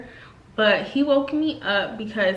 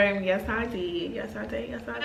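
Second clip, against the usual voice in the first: ordinary talk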